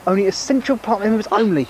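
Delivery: high squeaky puppet voice; squeaky ventriloquist dummy. Acted. Narrator puppet speaks high.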